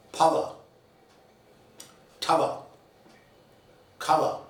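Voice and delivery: power, tower, cover with aspirated p, t, k; forceful initial stops. Shown as a demonstration. Teacher showing English aspirated stops to students. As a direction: puff of air on the first sound of power, tower, cover. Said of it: "the sounds are said with force".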